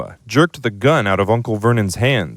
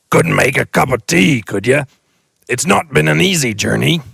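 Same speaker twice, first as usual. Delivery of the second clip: loud, high, homely giant voice; a big friendly giant asking for tea; gruff giant; 5 semitones higher, 4 dB louder than usual. Acted. The giant asking the family for tea, loud and friendly.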